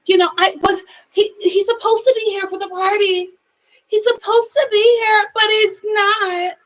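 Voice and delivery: high, whimpering imitation of her crying; mock teary complaint. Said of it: "she's just like".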